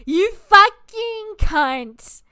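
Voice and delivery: retold furious outburst; furious insult. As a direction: spit each word out in rage. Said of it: "And I'm like".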